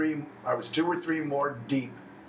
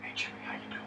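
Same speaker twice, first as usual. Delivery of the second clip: hushed whispered greeting, far lower and softer; 11 dB quieter, much faster than usual. Shown as a demonstration. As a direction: whisper the hello as if sharing a secret. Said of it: "Why are we whispering?".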